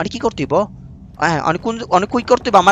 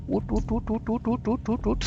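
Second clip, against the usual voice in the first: much softer breathy urging to climb; barely voiced so the monster can't hear; hushed climbing urge; near whisper; 15 dB quieter, much slower than usual. Real, not acted. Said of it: "sound করন যেত না".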